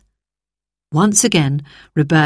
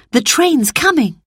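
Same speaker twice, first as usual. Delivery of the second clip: eager; 9 semitones higher than usual. Acted. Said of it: "said Roberta".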